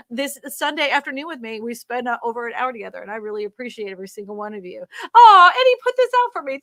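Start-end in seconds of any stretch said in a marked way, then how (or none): 5.1-6.6 much higher, louder gasp of delight; delighted surprise. Real, not acted. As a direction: react to an unexpected gift. Surprised by Eddie's gift.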